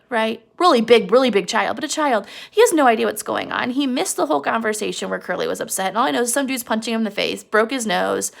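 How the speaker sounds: ordinary delivery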